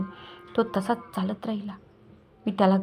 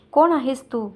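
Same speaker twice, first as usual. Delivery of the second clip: sharp demand; 4 semitones higher, 7 dB louder than usual. Acted. Lalit confronts her, stranger tone.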